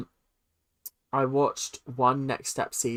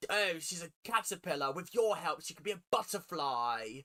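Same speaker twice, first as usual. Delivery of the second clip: mock British character accent; caricatured British accent; 10 dB quieter than usual. Viewer mocking how British people are characterized, British-accent imitation. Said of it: "this is how British people are characterized".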